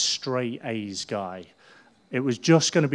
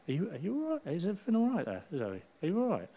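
gentle concern; 5 semitones higher, 6 dB quieter, faster than usual. Retold or imitated. Checking on silent glazed Zoe.

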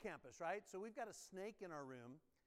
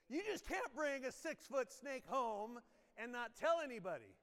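ordinary delivery

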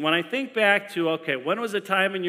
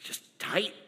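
much softer, breathy near-whisper of a woman in labor; soft, strained wife; 3 semitones higher, 14 dB quieter than usual. Retold or imitated. Wife in labor tells husband it's just tight, a soft near-whisper. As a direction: hold back the pain and barely voice it. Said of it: "She's like".

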